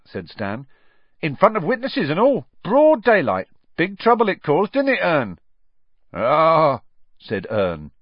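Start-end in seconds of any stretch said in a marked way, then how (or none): 1.2-5.4 high, loud, excitable gossip; chatty Stan. Acted. 6.1-6.8 drawn-out, loud dark grunt. A narrator's reading.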